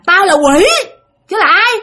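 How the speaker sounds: put-on high, loud demon-child retort; possessed boy defiant. Demon in the boy answers the priest, high defiant voice. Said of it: "nó trả lời cha xứ vậy nè".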